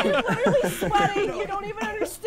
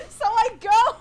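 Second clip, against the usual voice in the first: ordinary delivery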